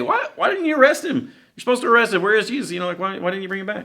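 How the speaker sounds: mock outrage at the useless police; shrill indignant high-pitched Pharisee; exasperated Pharisees. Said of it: "they say".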